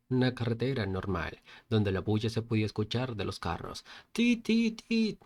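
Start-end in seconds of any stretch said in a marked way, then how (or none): 4.1-5.2 high-pitched honking "tit, tit"; imitated car horns. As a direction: mimic traffic horns with your voice. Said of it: "muy fuerte".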